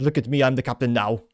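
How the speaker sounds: put-on botched Somali accent, landing near Arnold Schwarzenegger; bad accent attempt. Host quoting a film line about Somalians, badly faked accent. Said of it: "that was a bad accent attempt".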